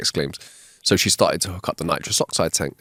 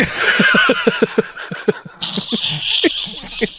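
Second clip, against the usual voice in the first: laughing. Real, not acted. Laughs at own joke.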